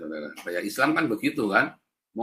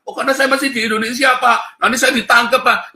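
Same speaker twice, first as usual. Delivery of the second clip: a hint of a higher, whiny excuse voice; timid excuse; 10 semitones higher, 6 dB louder than usual. Preacher imitating an apologist afraid of arrest, higher pleading tone. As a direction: lightly mimic a scared apologist pleading.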